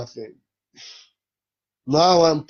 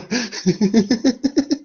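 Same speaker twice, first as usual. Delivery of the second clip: hearty laugh; 11 semitones higher, 4 dB louder than usual. Real, not acted. Bursts out laughing at own joke.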